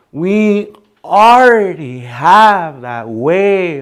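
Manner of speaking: "already" and "way" stretched high and loud; drawn-out lilt. Shown as a demonstration. Teacher shows the valley's way of talking, slow drawn-out lilt.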